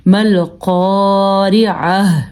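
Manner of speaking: slow مل قارعہ ending on a breathed h; long held قا, throaty ع, audible final ہ in مل قارعہ; ha in pausing. Shown as a demonstration. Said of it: "میں ہاں بن جاتی ہے". (مل قارعہ، آخر میں ہا کی آواز)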